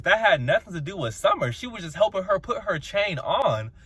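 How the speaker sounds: ordinary talk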